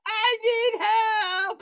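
very high, slow wail for help; hammed-up scream, stretched right out; mock screaming. Vomiting guest wailing to his host, mock screaming. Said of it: "He's screaming".

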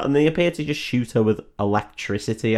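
ordinary talk

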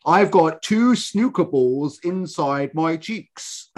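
slow, drawn-out fake British accent; bad British accent. Podcaster mocking Americans' attempts at English, bad British accent. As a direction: overdo a badly imitated British accent. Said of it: "try to do a British accent, but do it badly".

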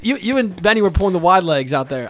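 loud, laughing jab; laughing tease. Real, not acted. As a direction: rib him through laughter. Teasing friend about seat holding.

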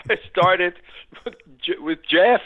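loud, laughing delivery; real laughter bursting through the words; laughing while talking. Real, not acted. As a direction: crack up while delivering the punchline. Laughs telling his huge pay jump.

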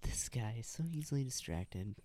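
soft unvoiced whisper aside; teasing whisper. Real, not acted. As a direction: whisper it behind his back. Whispered aside about partner.

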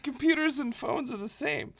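hammed-up naive friend; mocking naive friend. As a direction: play the friend who thinks it's all the same.

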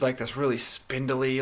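ordinary talk

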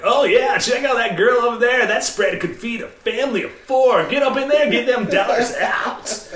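high, loud sleazy club patter; laughing strip club DJ come-on; sleazy DJ hype. Shown as a demonstration. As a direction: hype the crowd with innuendo. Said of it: "the dirtiest things you can say".

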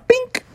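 high-pitched little bink; sticking sound. Retold or imitated. Sign magnetizes onto the car roof, high bink. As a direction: pop out a tiny high impact noise.

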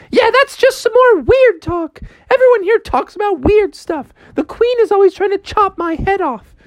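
put-on very high-pitched girl's voice, fed up; high, weary grumbling about weirdness; exasperated Alice. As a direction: complain in a high put-on girly voice.

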